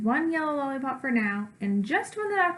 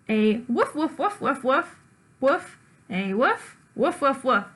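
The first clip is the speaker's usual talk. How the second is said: puppy barking. Acted. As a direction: bark on and on like a stubborn puppy. Barking refusal as a dog.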